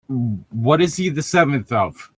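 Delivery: ordinary delivery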